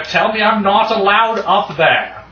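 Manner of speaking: calling upstairs. Acted. Player calls up the stairs.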